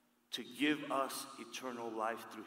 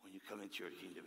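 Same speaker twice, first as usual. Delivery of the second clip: soft, hushed plea to Jesus; humble plea; 7 semitones lower, 11 dB quieter, faster than usual. Acted. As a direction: ask gently, barely above a breath. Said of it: "And he says".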